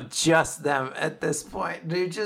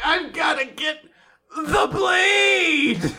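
hammed-up anguished cry; 11 semitones higher, slower than usual. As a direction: overact a desperate cry. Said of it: "It's so dramatic".

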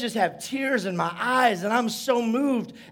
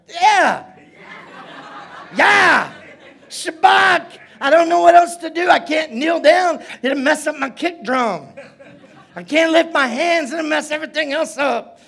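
high, loud praise hollering; comic worked-up shout; reenacted yelling; 6 semitones higher, 5 dB louder than usual. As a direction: yell as if you can't contain it. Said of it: "So I yell".

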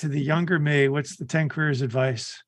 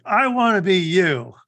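retold awe; 4 semitones higher, 5 dB louder, slower than usual. Retold awed reply to high earner.

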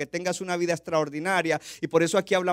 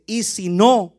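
Moses' desperate plea; 4 dB louder, slower than usual. Acted. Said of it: "Y dice".

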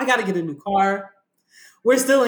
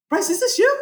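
high disbelief; 9 semitones higher than usual. Retold or imitated. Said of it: "you're like".

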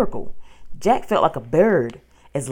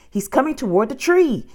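high-pitched urgent warning; alarmed cry; 8 semitones higher, 4 dB louder than usual. A narrator's reading. Girl warns the dinosaur nears the tree, alarmed cry. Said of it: "Annie cried".

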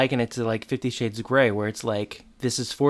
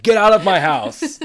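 hammed-up order, higher and louder; mock yell; 8 semitones higher, 8 dB louder than usual. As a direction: kick him out in a cartoonish shout. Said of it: "Mister Matthews is like".

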